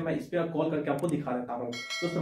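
ordinary talk, nothing marked

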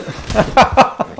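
high breathless burst of laughter. Real, not acted. Player laughing at his horse blunder, high breathless laugh. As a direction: laugh out helplessly at the mishap.

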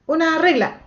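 high bright model answer; demo answer. Shown as a demonstration. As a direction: show the kids how to answer, up high. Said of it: "tienen que decir".

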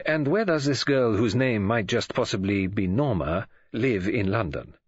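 slow, measured foreign detective voice; Poirot, probing. Acted. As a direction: inquire deliberately, weighing each phrase.

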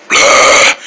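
loud, rasping unvoiced blah; hammed-up menacing blah; dark voice. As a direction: growl it out in a dark monster voice. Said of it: "Where you get that dark voice from?".

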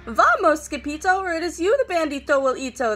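loud, urgent warning to flee; panicked shout to run; frightened cry. A narrator's reading. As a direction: cry of alarm. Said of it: "they cried".

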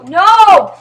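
high, loud cry of protest; dismayed yell. Real, not acted. Gamer sees players leave the lobby, dismayed yell. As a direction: yell it out in frustration.

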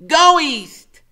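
very high, loud command; a boy knight giving orders; Freak's voice. A narrator's reading. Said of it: "he says".

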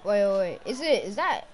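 ordinary talk

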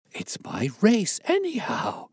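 high, drawn-out astonished exclamation; gasped. A narrator's reading. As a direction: overcome with relief, voice leaping up. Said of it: "gasped the Colonel".

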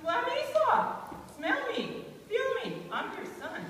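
high, loud, incredulous insistence; acted exasperated outburst; indignant protest. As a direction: insist on who you are, voice leaping up. Real Esau protests who he is.

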